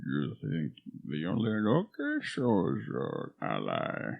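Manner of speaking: hammed-up villain monologue; masked villain parody. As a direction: intone it softly like a movie villain. Movie villain parody of Bane.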